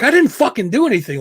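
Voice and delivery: angry protest. Retold or imitated. To the monitor who blames him, rising angry protest. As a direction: snap back, fed up and innocent. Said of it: "now I'm getting pissed".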